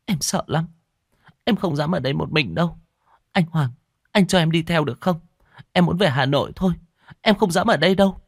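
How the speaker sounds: high, pleading frightened voice; scared pleading. A narrator's reading. Frightened girlfriend begs to go with him, pleading. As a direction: beg him not to leave her alone.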